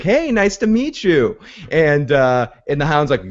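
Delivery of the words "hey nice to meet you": Brienne's greeting. Retold or imitated. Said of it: "Brienne initially introduces, like".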